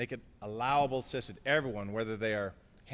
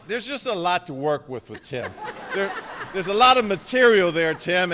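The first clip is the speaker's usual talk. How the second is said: laughing through a friendly jab; louder, chuckling as he teases; 5 semitones higher, 10 dB louder than usual. Real, not acted. Teasing his friend Tim before the crowd, laughing.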